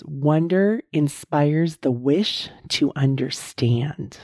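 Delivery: very slow, every word weighed; slow deliberate reading. Shown as a demonstration. Host reading the wonder definition to listeners, slow and deliberate. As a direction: read the definition out slowly so it sinks in. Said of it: "I'm gonna say that again".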